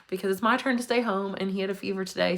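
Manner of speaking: ordinary talk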